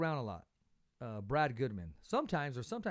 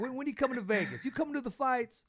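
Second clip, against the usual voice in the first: high, friendly imitated accent, louder; Brad Goodman's accent; 9 semitones higher, 4 dB louder than usual. Host mimics Brad's dinner invite, high-pitched accent. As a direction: invite him to Vegas in the promoter's accent, pitched up. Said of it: "he has that accent".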